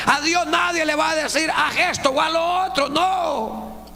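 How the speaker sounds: high, loud fervent preaching; full-force preaching. Acted. Preacher exhorting the brethren, high and loud. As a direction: drive the point home.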